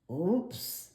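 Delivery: sheepish rising oops; a sheepish little oops; Froggy's oops. A narrator's reading. Froggy caught on the table, a sheepish oops. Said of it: "said Froggy".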